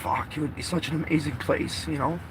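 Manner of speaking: quiet, emotional aside. Real, not acted. Man moved by thoughts of his father's care, emotional. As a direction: say it softly, a little choked up. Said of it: "I get a little emotional".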